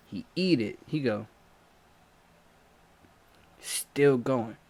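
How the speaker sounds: ordinary talk, nothing marked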